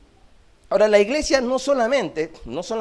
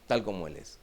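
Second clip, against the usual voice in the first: soft echo; 11 semitones lower, 8 dB quieter than usual. Shown as a demonstration. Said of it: "quiet echo of verse".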